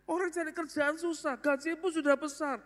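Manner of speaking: high, exasperated father pleading; can't believe the son quit; father bewildered that his son quit a big salary, high; baffled father. Retold or imitated. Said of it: "Papa nih bingung".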